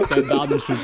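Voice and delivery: laughing, slowed down. Real, not acted. Laughing at his friend's story about the teens. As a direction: laugh through the words.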